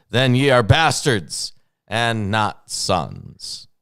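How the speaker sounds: loud, emphatic King James declamation; dramatic scripture reading. Acted. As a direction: declaim the verses with stretched key words.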